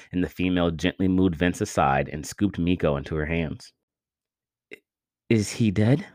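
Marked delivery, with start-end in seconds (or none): 4.7-6.1 broken, fearful stammer. A narrator's reading. Vince asking the warriors about Miko, stammering. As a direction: stumble over the words in fear. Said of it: "Vince stammered".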